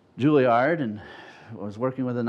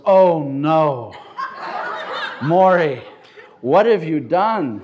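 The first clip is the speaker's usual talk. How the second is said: louder, slow mock dismay; mock alarm; 4 semitones higher, 7 dB louder, much slower than usual. Leader reacting to his tongues, loud mock dismay. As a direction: act out a leader's mock horror. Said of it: "He goes".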